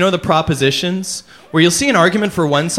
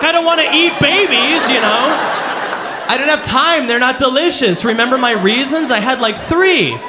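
frantic shout; 6 semitones higher than usual. Retold or imitated. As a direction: shout it like a flustered voter. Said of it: "you're just sitting there like".

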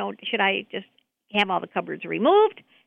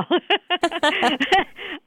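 laughing; 7 semitones higher than usual. Real, not acted. Caller laughs at budget joke.

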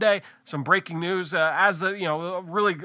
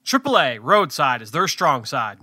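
upbeat sponsor read, punchy and louder; 5 dB louder than usual. Acted. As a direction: sell the sponsor like a radio spot. Host reads sponsor ad.

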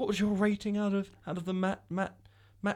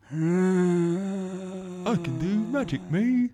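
imitating; much slower than usual. Imitating Eddie Redmayne's character.

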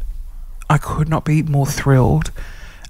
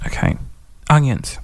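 ordinary delivery

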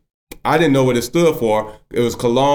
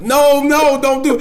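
high, louder cry of no, no; panicked plea; 14 semitones higher, 4 dB louder than usual. Retold or imitated. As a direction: beg him to stop, half-laughing in shock. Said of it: "I'm on the phone like".

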